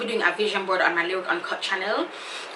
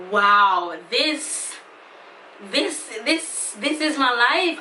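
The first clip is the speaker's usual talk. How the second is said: amazed wow; 3 semitones higher, much slower than usual. Real, not acted. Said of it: "I'm just like".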